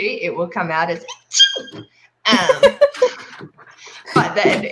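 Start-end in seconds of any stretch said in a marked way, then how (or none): 2.2-3.2 high, squeaky stifled sneeze; holding back a sneeze. Retold or imitated. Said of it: "if I'm trying not to sneeze".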